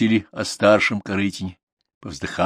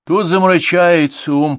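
complaining; 10 semitones higher, 6 dB louder, slower than usual. Acted. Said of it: "пожаловался она".

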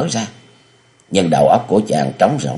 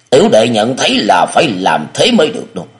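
firm and serious, a little higher; stern reply; 6 semitones higher, 3 dB louder than usual. Acted. Địa Linh overrules his elder brother, stern. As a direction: insist gravely on the plan. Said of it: "nghiêm nghị đáp".

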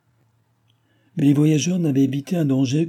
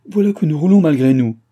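loud, higher-pitched alarm; loud cry of dismay; alarmed outcry; 5 semitones higher, 9 dB louder than usual. A narrator's reading. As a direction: cry out as control slips away. Alarm as coach rolls away.

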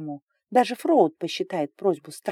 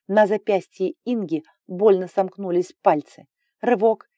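ordinary delivery, nothing marked

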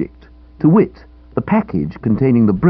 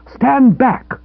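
higher, louder cry of defence; cried out; 13 semitones higher, 4 dB louder than usual. A narrator's reading. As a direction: fend him off with a sharp cry. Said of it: "I cried".

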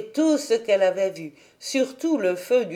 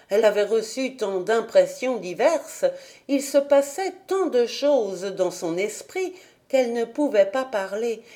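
usual speaking voice

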